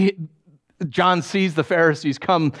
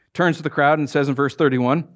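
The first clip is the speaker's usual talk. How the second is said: ordinary talk, nothing marked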